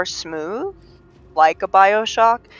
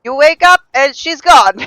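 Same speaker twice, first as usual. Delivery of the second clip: high, loud hammed-up Russian accent; Yakov Smirnov; 10 semitones higher, 10 dB louder than usual. Retelling a stand-up's joke, in a loud Russian accent. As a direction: deliver the punchline in a big mock Russian accent. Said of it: "he said that there's not a lot of comedy in Russia".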